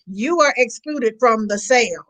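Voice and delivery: ordinary talk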